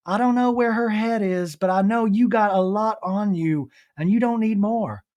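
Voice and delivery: high, put-on advising mom; Robin. Mom advises daughter on FaceTime, mock motherly. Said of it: "with her mom, Robin".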